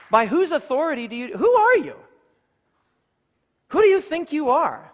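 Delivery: loud, high, outraged demand; indignant challenge. Acted. Expelled temple crowd confronting Jesus, high and shouting angrily. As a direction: fling the question at him in outrage.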